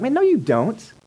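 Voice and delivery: high-pitched, acted pushback; exasperated retort. Listener rebuffs a would-be comforter, exasperated retort. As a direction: push back as if fed up with sympathy.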